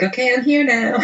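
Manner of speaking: high-pitched imitation of an arrogant wrestler; mock diva entrance. Co-host imitating Eva Marie's arrogant entrance, mock diva. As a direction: announce yourself in a put-on high voice.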